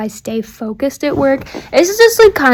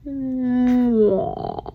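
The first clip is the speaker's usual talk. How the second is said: long drawn-out kiss sound, softer; slow motion kiss; 7 dB quieter than usual. Pretend. Playful slow-motion kiss blown to her sister. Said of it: "Slow motion kiss".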